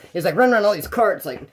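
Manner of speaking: normal talking voice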